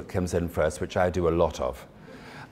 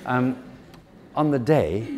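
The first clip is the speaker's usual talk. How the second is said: normal talking voice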